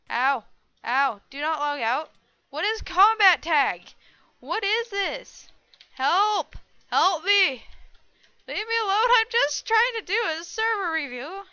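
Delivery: loud, high cries for help; loud, high-pitched, exasperated pleading; panicked yelling. Real, not acted. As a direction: yell in protest while being attacked. Attacked in game, begging for help.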